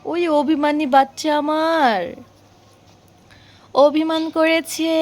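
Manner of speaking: slow, fond and drawn out; tender slow teasing. Real, not acted. Mother fondly describes her sulking little boy, slow and tender. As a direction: savor each word, lovingly teasing.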